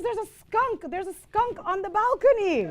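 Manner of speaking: high-pitched alarmed mother; mock panicked mother voice. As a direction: squeal it out like a startled mom. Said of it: "And she goes".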